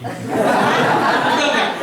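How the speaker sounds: slow, loud, laughing "Betul nggak?"; laughing as you ask the crowd; laughing question. Real, not acted. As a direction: drag it out through a laugh. Laughing rhetorical question to audience.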